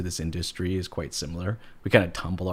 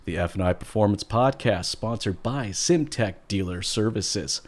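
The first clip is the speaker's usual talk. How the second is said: loud, booming radio host intro; big hyped announcer delivery; 5 dB louder than usual. Shown as a demonstration. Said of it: "That's my best radio announcer voice".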